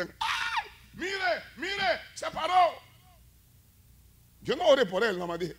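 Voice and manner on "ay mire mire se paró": high, amazed shout from the crowd, slowing on se paró. Retold or imitated. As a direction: mimic a stunned crowd crying out. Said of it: "la gente grita". (la gente gritando asombrada, aguda)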